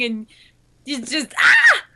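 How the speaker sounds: mock panic scream. Panicked running through hallways.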